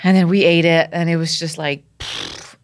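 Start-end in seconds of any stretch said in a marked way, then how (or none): 2.0-2.6 soft puff of air through the lips; pfft noise. Retold or imitated. Diver after eating the fish, soft dismissive puff. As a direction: let out a quiet, voiceless pfft. Imitates a noise while retelling.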